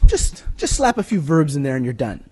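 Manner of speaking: ordinary talk, nothing marked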